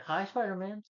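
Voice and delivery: high-pitched put-on hello; mock greeting. Iron Man greeting, retold.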